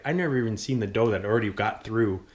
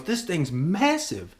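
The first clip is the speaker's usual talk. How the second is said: impressed uncle; 8 semitones higher, much slower than usual. Retold or imitated. Uncle marvels at deer size.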